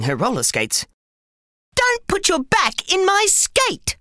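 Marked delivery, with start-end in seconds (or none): 1.7-4.0 put-on high, slow little girl retort. A narrator's reading. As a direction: snap it back in a high girl's voice. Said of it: "retorted Lulu".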